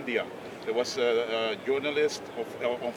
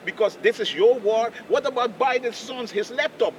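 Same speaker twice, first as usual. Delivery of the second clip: higher, louder, slowed rant; retold furious rant; 9 semitones higher, 6 dB louder than usual. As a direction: hurl the questions back at him. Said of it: "he was flipping out".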